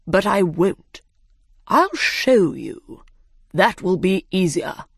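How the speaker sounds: slow, grand bird voice; dodo voice. A narrator's reading. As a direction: say it slowly and importantly. Dodo speaks.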